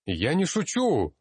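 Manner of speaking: high, drawn-out near shout of protest; insist, voice leaping up, slow and emphatic; almost shouting. A narrator's reading. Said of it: "почти крикнул я".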